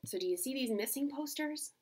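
ordinary delivery

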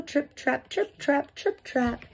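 high, light trip-trap patter; dainty hooves tapping across a bridge; little goat hoof steps. A narrator's reading. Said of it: "feet made that sound on the bridge".

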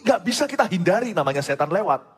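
high-pitched, forceful sermon delivery; impassioned preaching. Real, not acted. Father preaching that disaster need not shock us, impassioned. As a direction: drive each point home to the congregation, pitched high.

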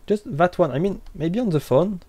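ordinary delivery

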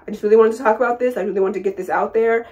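normal talking voice

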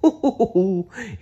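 high goofy friendly laugh; jolly dinosaur chuckle. Acted. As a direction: laugh like a big cuddly dinosaur. Barney appears laughing.

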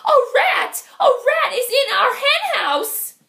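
high shrill alarm cry; alarmed hen. Acted. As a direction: shriek the warning to the flock.

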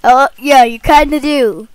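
ordinary delivery

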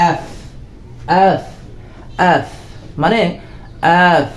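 "এফ" with air let out between the lips; F pronunciation demo. Shown as a demonstration. Teacher shows students the letter's sound, airy F pronunciation. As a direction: drill "এফ", each one set apart by a pause. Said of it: "এই ওয়ার্ডটি উচ্চারণ করব".

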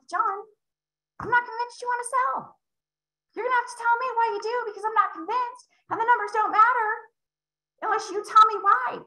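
gentle high questioning voice; nice, not mean. Shown as a demonstration. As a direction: ask it sweetly, never pushy. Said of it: "I'm nice about it".

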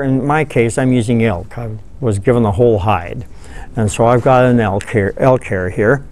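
normal talking voice

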